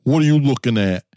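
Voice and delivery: imitated big-city New York challenge; New York dialect. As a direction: put it in a New Yorker's dialect. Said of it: "let me put it in your dialect".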